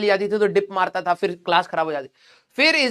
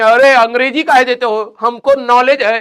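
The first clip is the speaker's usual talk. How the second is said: rebellious student mock; 8 dB louder than usual. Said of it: "विद्रोह करेंगे".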